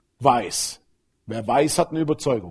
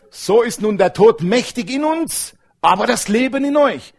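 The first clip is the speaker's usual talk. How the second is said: preaching scripture; 6 semitones higher, 4 dB louder than usual. Acted. Scripture read with force.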